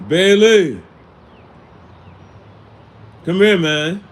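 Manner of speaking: loud, high call across the room; real shout to get attention. Real, not acted. Calls out to Bailey mid-talk.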